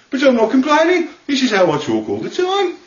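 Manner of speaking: high, aggrieved Birmingham moan; hammed-up Birmingham complaint; whining Brummie accent. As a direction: defend yourself in a Brummie whinge. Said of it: "talks like they're complaining".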